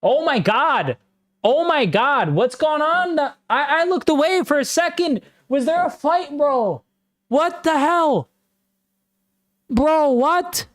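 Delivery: can't believe what he just saw, voice shooting high; shocked disbelief. Real, not acted. Streamer reacting live to a sudden scuffle, shocked high-pitched disbelief.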